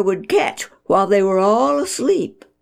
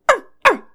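high yippy arr arr bark; sharp little puppy bark; 6 dB louder than usual. A narrator's reading. Narrator barks for the little puppy. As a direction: yip it like a tiny wet puppy. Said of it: "he heard a sharp little".

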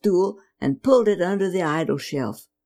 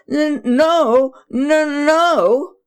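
put-on high, louder stuttering no from a scared boy; frightened stammer; 8 semitones higher, 5 dB louder, much slower than usual. Boy denying to his angry father, stammering in fear. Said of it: "Braddock stammered".

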